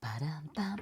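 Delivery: whispered beat. Acted. Playful transition whisper.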